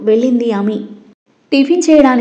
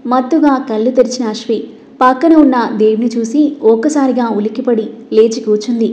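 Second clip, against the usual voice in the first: ordinary delivery, nothing marked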